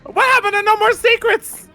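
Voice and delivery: very high-pitched mock outrage; betrayed indignation. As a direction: protest a broken pact in a squeaky uproar. Confronting friend over hidden sister.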